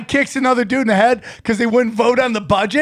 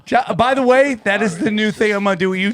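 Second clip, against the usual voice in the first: ordinary talk, nothing marked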